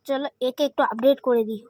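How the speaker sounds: ordinary talk, nothing marked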